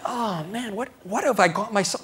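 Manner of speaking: high, fretful moaning; worked-up, self-pitying complaint; brooding. Retold or imitated. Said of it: "I'm brooding over it".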